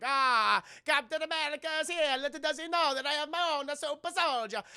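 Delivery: high, put-on Italian accent, smug; Italian villain accent. Mussolini gloating from the balcony about his super soldier, put-on Italian accent.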